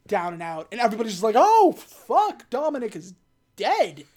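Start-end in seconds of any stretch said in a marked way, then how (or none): 1.4-4.0 high, loud burst on the first words; hammed-up panic as they see him fall; mock shocked cry. Everybody reacts to crash.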